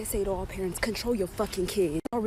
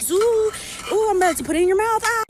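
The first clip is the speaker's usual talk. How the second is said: put-on high, fast, sing-song airplane noises for a toddler; loud playful baby talk, airplane spoon game; airplane feeding game; 10 semitones higher, 6 dB louder, faster than usual. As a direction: coo it like you're flying food into a kid's mouth.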